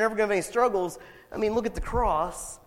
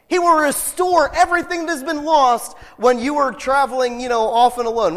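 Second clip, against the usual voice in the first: raised, emphatic preacher's voice; impassioned preaching; 5 semitones higher, 5 dB louder than usual. Acted. Preacher telling the congregation who Jesus is, emphatic. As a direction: proclaim it to the congregation.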